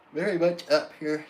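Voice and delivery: talking through a yawn; words stretched by a yawn. Real, not acted. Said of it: "How yawning".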